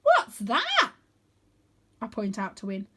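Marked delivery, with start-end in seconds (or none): 0.0-0.9 high, loud alarmed question. A narrator's reading. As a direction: call out at the strange cloud.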